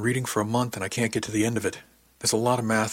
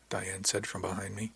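ordinary delivery

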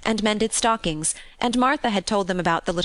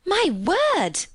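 much higher, swooping exclamation; delighted cry; 11 semitones higher than usual. A narrator's reading. Maid hearing of tiger hunts, delighted. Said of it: "cried delighted Martha".

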